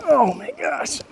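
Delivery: strained gasp under the weight; carrying heavy load. Real, not acted. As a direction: exclaim while lifting a heavy box. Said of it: "these things weigh".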